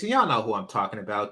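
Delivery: normal talking voice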